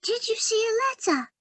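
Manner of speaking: high, quick whispered mouse voice; whispery mouse voice. Acted. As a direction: breathe it like a tiny mouse. Mouse character Penny asks.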